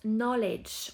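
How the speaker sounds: "knowledge" with no K, starting on N; silent K. Shown as a demonstration. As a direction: say "knowledge" as nollej. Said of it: "On ne prononce pas le K au début".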